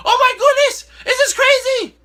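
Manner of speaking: loud, high-pitched excited outburst. Real, not acted. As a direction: burst out with excitement. Excited about live finals.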